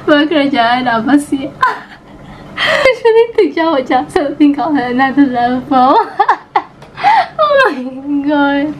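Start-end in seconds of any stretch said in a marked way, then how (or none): 2.6-8.7 high, breaking into laughter; real laughing fit. Real, not acted. Vlogger cracking up at exam memes, talking through laughter. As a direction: gasp the words out between laughs.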